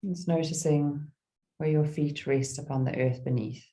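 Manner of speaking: calm meditation guide with long pauses; gentle, spacious mindfulness voice; guided meditation. Real, not acted. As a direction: ease listeners into stillness, phrase by phrase.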